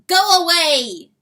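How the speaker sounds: high, loud, slow call; crowed warning. Acted. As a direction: crow the warning out. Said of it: "crowed the rooster".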